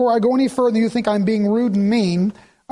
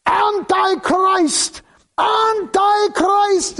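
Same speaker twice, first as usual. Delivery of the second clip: high, drawn-out heckling cry; imitated heckler's cry; 12 semitones higher, much slower than usual. Protestant heckler interrupting the Pope's speech, high drawn-out cry. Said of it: "you hear this voice. He goes".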